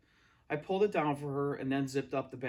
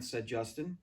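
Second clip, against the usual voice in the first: usual speaking voice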